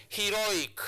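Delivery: "heroic" slow and high, the stressed syllable held; demonstrated word stress. Shown as a demonstration. Teacher shows where the stress marker goes, slow stressed "heroic". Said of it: "So he is the second last syllable".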